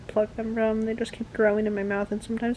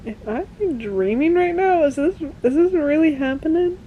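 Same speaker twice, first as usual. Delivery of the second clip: high, loud, amazed mock disbelief; stunned disbelief; 8 semitones higher, 6 dB louder than usual. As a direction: play Warner overwhelmed he can't believe it. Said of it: "Warner's like".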